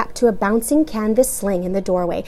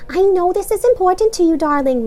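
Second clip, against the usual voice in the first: soothing mother; 10 semitones higher, 5 dB louder than usual. A narrator's reading. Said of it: "Mama added soothingly".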